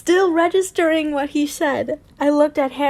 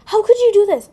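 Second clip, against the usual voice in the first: high, loud mock-horrified mother; shocked mom; 8 semitones higher, 6 dB louder, faster than usual. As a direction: play a scandalised mom. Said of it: "her mom's like".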